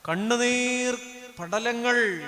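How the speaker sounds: ordinary talk, nothing marked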